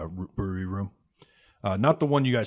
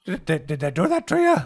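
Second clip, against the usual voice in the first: high, loud stammered worry; fearful for the boy; worried innkeeper; 9 semitones higher, 7 dB louder than usual. Acted. Innkeeper asks about injuries.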